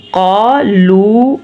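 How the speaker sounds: "قا" and "لو" each held long; lengthened syllables. Shown as a demonstration. Teacher isolating the long letters, lengthened syllables. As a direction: stretch "قا", then "لو". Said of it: "کیوں لمبے کیے ہیں؟".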